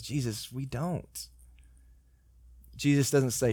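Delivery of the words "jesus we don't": quiet confession. Retold or imitated. Thomas confessing confusion.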